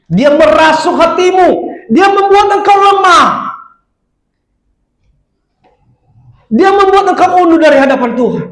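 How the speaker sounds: high, loud warning from the pulpit; forceful preaching. Real, not acted. (khotbah lantang penuh peringatan)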